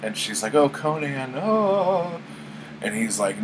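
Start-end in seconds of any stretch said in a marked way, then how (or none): 0.5-2.2 high-pitched, imitated lovelorn sigh; hammed-up damsel, rising higher on the last drawn-out word; mock swooning queen. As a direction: play a swooning queen pleading with her hero. Queen pleads with departing Conan.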